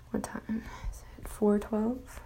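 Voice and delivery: whispering to herself, checking the time; whispered aside. Real, not acted. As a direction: murmur it under your breath.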